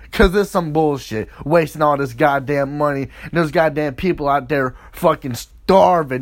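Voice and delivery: ordinary delivery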